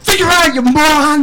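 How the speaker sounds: high, loud mock yell; boss yelling. Boss yelling at Oscar over the phone, loud mock yell. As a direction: bark the insult and slam the phone down. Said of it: "He's like".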